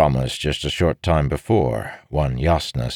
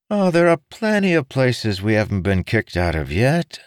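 higher-pitched, joking monk voice; cheery monk; 7 semitones higher than usual. A narrator's reading. Monk joking with his discouraged friend, cheery. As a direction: make light of the setbacks.

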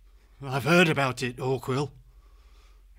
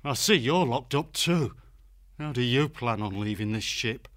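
bitter irony; 4 semitones higher, 3 dB quieter than usual. Acted. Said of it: "tinged with bitter irony".

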